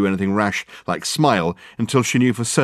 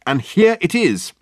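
high, loud, slow reveal; drawn-out, gleeful announcement; triumphant exclamation; 10 semitones higher, 5 dB louder than usual. A narrator's reading. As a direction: present it with a flourish. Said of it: "slapped the piece of paper triumphantly".

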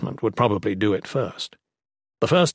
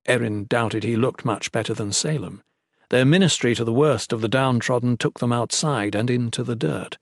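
ordinary delivery

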